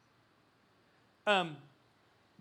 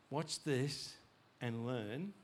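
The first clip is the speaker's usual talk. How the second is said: lower, softer knowing murmur; low quiet aside; 6 semitones lower, 6 dB quieter, much slower than usual. Acted. Preacher voicing God's gentle side to the church, low and soft. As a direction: drop low as if letting them in on it. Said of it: "which can swing between".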